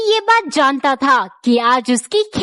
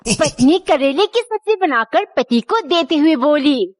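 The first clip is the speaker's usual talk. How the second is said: usual speaking voice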